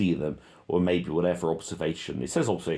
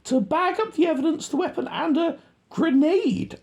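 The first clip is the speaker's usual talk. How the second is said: much higher, louder mock radio talk; squeaky radio voice; 5 dB louder than usual. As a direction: mimic a soldier on the radio, squeaky. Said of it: "Speaking on the radio".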